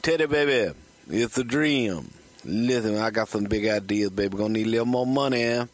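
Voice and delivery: Dusty Rhodes impression. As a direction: sweet-talk Ted Turner like Dusty. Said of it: "Dusty started leaving messages".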